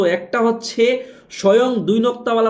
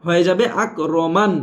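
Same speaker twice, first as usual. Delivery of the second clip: normal talking voice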